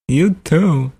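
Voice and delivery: high-pitched celebrity impression sign-off; Christopher Walken. Prank caller ending the call, celebrity impression. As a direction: sign off high in the star's lilting voice. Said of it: "Christopher Walken".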